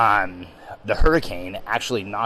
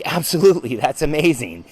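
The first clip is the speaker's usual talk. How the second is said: higher and louder eager yes; thrilled yes; 5 semitones higher, 4 dB louder than usual. Retold or imitated. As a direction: jump at the invitation, delighted. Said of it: "And I'm like".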